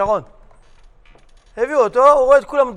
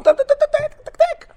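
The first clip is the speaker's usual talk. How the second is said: high imitated clicking.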